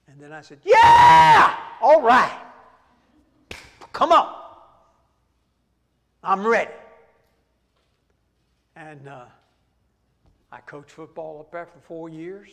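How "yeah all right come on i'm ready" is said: sudden loud whoop of joy, pitch shooting up; excited yell. Retold or imitated. Pastor accepting the coaching job with a joyful shout. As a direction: burst out cheering, ready to go. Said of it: "I took that very calmly".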